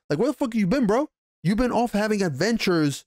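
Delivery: high, exasperated scolding; wife berating absent husband, mock angry; mock exasperated rant. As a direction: scold him for being away.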